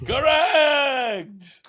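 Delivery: high, loud cry; excited shout. Real, not acted. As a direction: call it out triumphantly. Announces correct guess.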